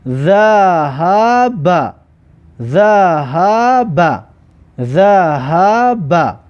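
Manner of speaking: ذهب drawn out very slowly; slow demo pronunciation. Shown as a demonstration. Teacher sounds out ذهب very slowly for pupils. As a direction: stretch ذهب slowly, syllable by syllable.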